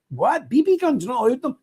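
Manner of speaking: high, incredulous squeak of a question; mock astonished protest; retold disbelief. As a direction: act out the shock at the rule. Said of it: "I said".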